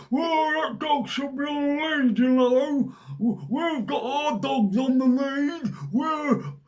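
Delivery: high hammed-up shout, slow at the end; mock rule-follower. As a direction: squawk it like a smug rule-obeying busybody. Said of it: "which are gonna be going".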